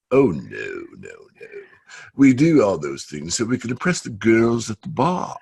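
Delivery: put-on a light, imperfect stab at a posh British Cambridge accent; rough British Cambridge accent. A day-trader friend telling his joke, in a mock British Cambridge accent. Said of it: "I can't do a proper Cambridge accent".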